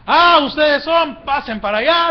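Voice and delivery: loud, high bullying orders; overbearing guard shouting commands; despotic jailer. Acted. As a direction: order prisoners about roughly. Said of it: "son personas déspotas".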